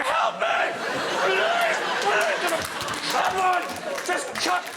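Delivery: very high, shrieking cry for help; hammed-up panicked shriek; panicked hoover. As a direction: scream for rescue like a drugged-up hoover. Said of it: "coked off his nuts going".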